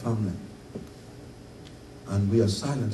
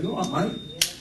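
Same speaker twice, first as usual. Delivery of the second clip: put-on light Cockney accent, higher and breezy; 7 semitones higher than usual. Preacher imitating a Cockney terrorist's casual Cockney greeting. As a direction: toss off a friendly Cockney greeting. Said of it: "a man with a Cockney accent saying".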